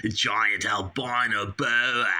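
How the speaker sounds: hammed-up Aussie vowels; Australian accent. Snake handler boasts about his catch, mock Australian accent. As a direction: put on a broad Australian accent. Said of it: "Oh, I like Australian".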